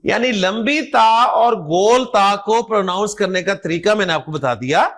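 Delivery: ordinary delivery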